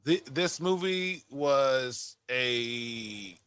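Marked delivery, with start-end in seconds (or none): none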